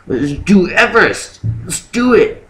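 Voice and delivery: mock hyped. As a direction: jump at the idea like it's nothing.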